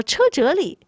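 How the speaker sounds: mildly startled questioning; taken aback. Acted. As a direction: repeat it haltingly, as if caught off guard. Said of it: "就愣了一下".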